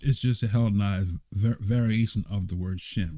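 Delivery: ordinary delivery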